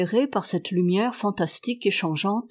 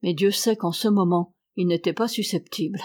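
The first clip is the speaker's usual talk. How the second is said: usual speaking voice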